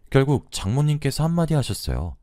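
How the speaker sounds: ordinary delivery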